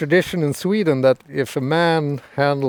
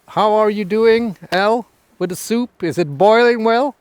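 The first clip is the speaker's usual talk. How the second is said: raised voice calling across to her; 6 semitones higher, 5 dB louder than usual. Real, not acted. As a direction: call over to the cook. Calls over to Elle cooking.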